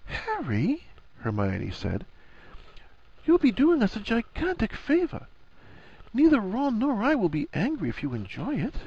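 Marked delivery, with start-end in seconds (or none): 0.0-0.8 high, soft, rising name; Hermione, gentle. A narrator's reading. Hermione addressing her anxious friend, gentle. 3.2-8.8 high-pitched woman's character voice; Hermione, earnest reassurance. A narrator's reading. Hermione telling Harry nobody will be angry, reassuring.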